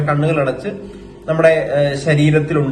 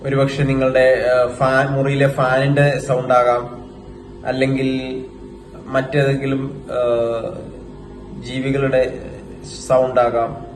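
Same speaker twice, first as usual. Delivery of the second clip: guided meditation; 3 semitones lower, 6 dB quieter than usual. Acted. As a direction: guide them into stillness, phrase by phrase, in an even tone. (ധ്യാനത്തിലേക്ക് നയിക്കുന്ന സാവധാന ശബ്ദം)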